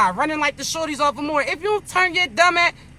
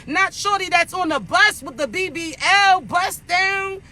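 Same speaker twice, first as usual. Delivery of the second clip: ordinary talk, nothing marked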